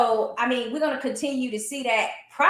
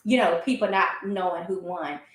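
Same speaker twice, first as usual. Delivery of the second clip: ordinary delivery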